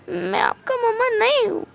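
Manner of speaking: high, wailing protest; indignant whine. Acted. Spouse refusing to be husband's mother, whiny.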